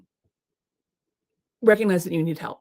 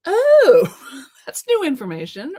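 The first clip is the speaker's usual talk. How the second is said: loud high Oh, then a laugh; mock surprise; 8 semitones higher, 9 dB louder, slower than usual. Advisor's reply startles the doubter, loud high gasp. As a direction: jolt at unwelcome news.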